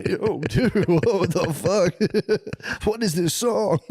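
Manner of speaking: high voice through laughter; high laughing disbelief. Retold or imitated. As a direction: giggle through the quote. Acted quote laughing.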